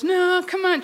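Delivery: mock insistence that won't take no; insistent coaxing. Friend won't give up on the trip, retold insistent coaxing. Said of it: "She wouldn't give up".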